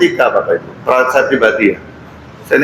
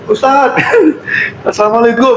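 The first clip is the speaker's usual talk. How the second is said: high-pitched visitor's call; 12 semitones higher than usual. Retold or imitated. Neighbour dropping food at the isolating ustaz's fence, calling out high. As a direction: call out a greeting from outside, high.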